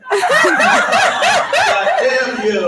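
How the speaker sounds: drawn-out laughing cry, high and loud. Real, not acted. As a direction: stretch it out through a laugh. Laughing at crowd.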